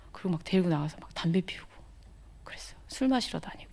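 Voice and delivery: telling the congregation about past drinking, whispered; whispered confession. Real, not acted. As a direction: let it slip as a hushed secret.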